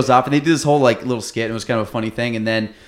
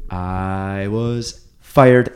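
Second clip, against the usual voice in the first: very slow, drawn-out and a bit louder; slow, heavy reveal of a firing; deadpan punchline; 2 semitones lower, 4 dB louder, much slower than usual. Retold or imitated. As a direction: let each word hang for the laugh. Sandler's deadpan punchline in monologue.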